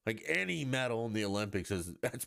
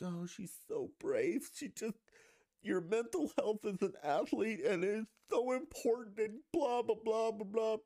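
mocking praise; 12 semitones higher than usual. Mocking fans who praise the athlete, high sarcastic gush. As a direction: gush sarcastically in a much higher voice. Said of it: "and they're like".

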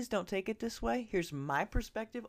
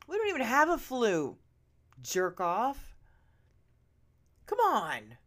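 loud outraged yell; 3 semitones higher, 5 dB louder than usual. Real, not acted. Vlogger yelling at the six-month-shot doctor, loud outrage. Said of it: "Sorry, I did not mean to yell in your ear pods".

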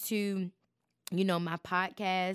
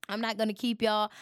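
ordinary delivery

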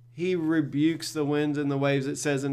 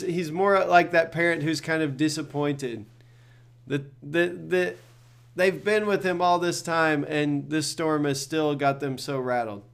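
ordinary talk, nothing marked

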